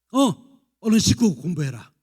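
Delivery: loud curt mother's order; nagging mother. Retold or imitated. Mother ordering exhausted teen at 11pm, loud and curt. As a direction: snap at the kid to wash and study. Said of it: "엄마가 이렇게 말할 때래요".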